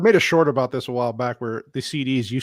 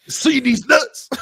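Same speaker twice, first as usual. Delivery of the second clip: gruff truck driver impression; higher, put-on trucker voice; Lou Gossett voice; 9 semitones higher, much slower than usual. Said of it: "That was a truck driver".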